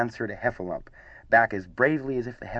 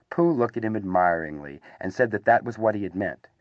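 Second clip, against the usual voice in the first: plain reading voice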